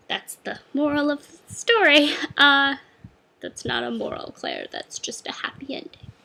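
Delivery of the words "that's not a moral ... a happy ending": hushed whisper to herself; whispered aside. Real, not acted. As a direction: whisper a dry correction to yourself. Whispered self-correction aside.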